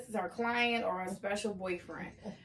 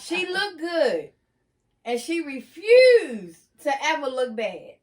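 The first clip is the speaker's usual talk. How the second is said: slow, loud, drawn-out emphasis; emphatic praise; 8 dB louder, slower than usual. Real, not acted. Narrator praises the aunt's poise, slow and loud. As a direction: lean hard into each word. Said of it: "emphatic praise of aunt".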